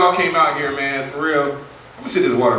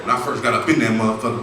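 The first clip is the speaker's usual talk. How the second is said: lower ex-con voice; 5 semitones lower than usual. Retold or imitated. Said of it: "he's like".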